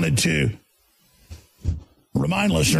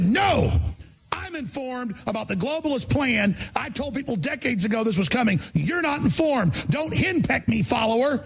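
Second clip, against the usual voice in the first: ranting; 7 semitones higher than usual. Retold or imitated. Retold yelling at shopper.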